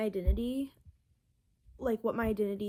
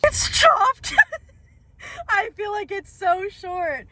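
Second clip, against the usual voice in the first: laughing; 10 semitones higher, 10 dB louder, slower than usual. Real, not acted. Reacting to new short hair.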